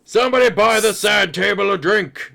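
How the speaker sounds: loud, high holler across a bar; yelling. Acted. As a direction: yell it out to the whole tavern. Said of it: "yells out the bar".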